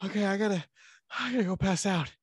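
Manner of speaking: high, mock-exhausted sign-off; out of breath. Winded man ending the call, mock breathless. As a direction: pretend to be puffed and about to collapse. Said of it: "But then I'm out of breath".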